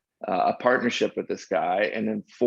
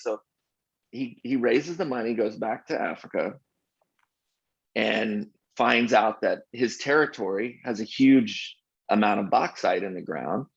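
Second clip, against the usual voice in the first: ordinary delivery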